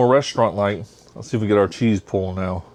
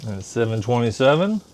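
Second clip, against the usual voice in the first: usual speaking voice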